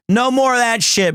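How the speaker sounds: usual speaking voice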